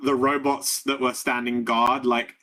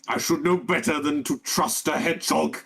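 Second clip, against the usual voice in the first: higher-pitched villain character voice; robot villain; 4 semitones higher than usual. Acted. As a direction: play the boss regretting his trust. GM voicing the enraged robot boss.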